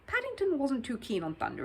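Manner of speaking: ordinary delivery, nothing marked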